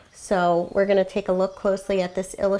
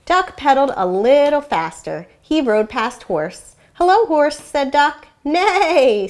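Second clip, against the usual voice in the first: lively, expressive storybook reading with animal voices; story read aloud to children; 3 semitones higher, 4 dB louder than usual. A narrator's reading. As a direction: read it like a classroom read-aloud, bringing each animal to life. Teacher reads picture book aloud.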